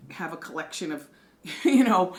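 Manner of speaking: ordinary delivery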